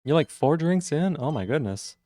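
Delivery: normal talking voice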